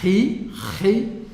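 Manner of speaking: ri with a rasping ch at the back of the throat; guttural ri. Shown as a demonstration. Teacher shows students the throaty ri sound. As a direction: push ri out from the throat. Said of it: "É um som gutural".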